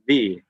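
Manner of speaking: long buzzed v opening "V"; demonstrated V sound. Shown as a demonstration. Teacher showing learners the sound, held buzzing V. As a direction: say "V" slowly, teeth against lip. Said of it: "like that".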